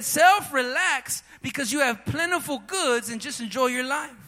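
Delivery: a rich fool lazily telling himself to relax; smug rich man. Acted. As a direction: lean back and boast to yourself. Rich man voiced talking to himself.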